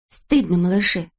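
ordinary delivery, nothing marked

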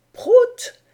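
high, loud donkey-driver's call; donkey-driver's cry. A narrator's reading. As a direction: shout it sharply upward, as if urging a donkey on. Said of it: "le cri magique des âniers".

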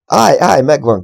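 loud, high excited shout. A narrator's reading. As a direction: call out the sudden breakthrough. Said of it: "kiáltotta Marcus". (izgatott, hangos kiáltás)

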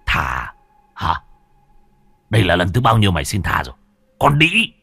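fast, rising to a shouted insult; husband scorns his wife's plea, harsh; angry killer. Acted. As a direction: spit it back in contempt. Sơn snaps back at wife.

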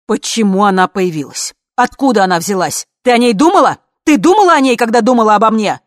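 rising, loud cross-examination; angry interrogation. A narrator's reading. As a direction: fire accusations, building to a shout. Accusing questions, building in force.

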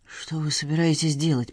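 hushed, uneasy question; whisper. A narrator's reading. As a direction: whisper it nervously. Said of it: "прошептал Леон".

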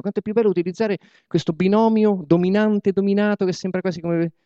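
ordinary talk, nothing marked

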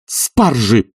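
high strained command breaking off; agitated, loud master; improvised order. A narrator's reading. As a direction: blurt out any errand, falling in pitch. Oblomov shouting invented errand.

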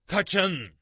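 high, loud warning shout. A narrator's reading. Lord John warning the party of snakes, loud shout. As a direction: yell at them to run. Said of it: "diye bağırdı Lord John".

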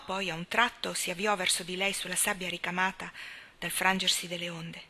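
plain reading voice

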